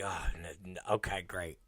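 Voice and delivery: low, very soft, defeated aside; quiet mock acceptance; muttered resignation. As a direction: mumble it to yourself, giving up. Resigned reaction to kids' antics.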